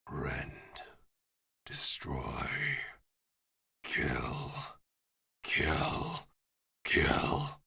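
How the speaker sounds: low, slow monstrous growl, each word set apart; menacing demonic voice. Acted. Game master voices what the player hears on gaining Glutton's Jaw, menacing. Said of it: "you hear this voice just echoing".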